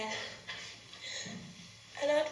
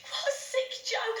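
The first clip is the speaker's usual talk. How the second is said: higher-pitched, appalled reaction; indignant disbelief; 8 semitones higher than usual. Acted. Reacting to an imagined prank from the friend, indignant.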